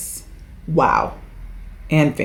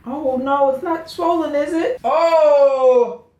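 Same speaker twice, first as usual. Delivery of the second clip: high-pitched worried wail; real dismay; 9 semitones higher, slower than usual. Real, not acted. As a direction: whine it out, dreading the worst. Finds her ankle might be swollen.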